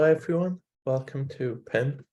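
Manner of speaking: normal talking voice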